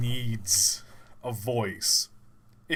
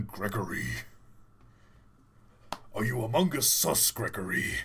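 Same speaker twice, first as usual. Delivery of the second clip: low, lightly put-on Freddy; mock the low bear voice; 5 semitones lower than usual. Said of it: "it's just me going".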